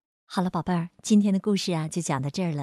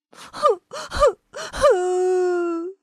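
high, loud hiccupping sobs; child's sobbing whimpers; 12 semitones higher, 5 dB louder, much slower than usual. Acted. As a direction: whimper like a crying little kid. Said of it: "慈母龙妈妈听到了哭声".

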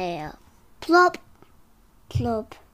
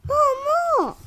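excited squeal; 14 semitones higher, 4 dB louder than usual. Acted. Begging in story.

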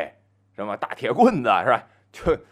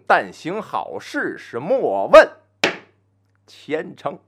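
slow, rising storyteller chant; slow, measured poem delivery; opening poem recitation; 4 semitones higher, 4 dB louder, slower than usual. Shown as a demonstration. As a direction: recite the opening verse slowly, with weight. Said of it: "说这么两句定场诗".

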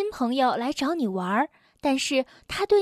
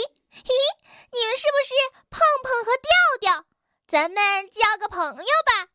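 very high, fast chattering busybody; pushy squeaky rabbit; 7 semitones higher, faster than usual. Acted. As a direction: chatter eagerly, a little too forward. Suqi character voice.

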